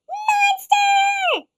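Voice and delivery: high, loud cheering shout. Acted. The monsters cheering their creation, shouting. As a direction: cheer the word together. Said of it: "They all cheered together".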